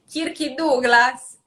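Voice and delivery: put-on slow, Italian accent, Kirky Douglas with an added vowel; Italian mispronunciation. Guest retells her parents naming actors in an Italian accent. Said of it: "They couldn't understand Kirky Douglas".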